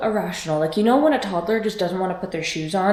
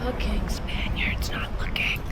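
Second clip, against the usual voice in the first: slow whisper so nobody hears; whispered aside; slower than usual. Real, not acted. Cyclist whispering about a passing rider, slow whisper. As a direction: hush it out behind his back.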